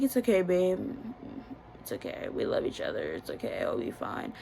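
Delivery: hushed, breathy whisper of comfort; soft reassuring whisper. Retold or imitated. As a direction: murmur it's okay like soothing someone crying. Boyfriend comforting after she cried.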